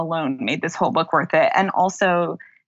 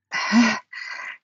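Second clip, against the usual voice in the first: a trembling sigh, holding back tears; shaky sigh; 4 semitones higher than usual. Real, not acted. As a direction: sigh shakily, close to crying. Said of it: "I'm gonna try not to cry".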